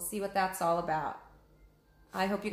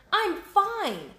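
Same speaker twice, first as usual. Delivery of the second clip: much higher, louder angry yell; shouted; 13 semitones higher, 6 dB louder than usual. Acted. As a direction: chase off the bird with a shout. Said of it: "Annie shouted at the vulture".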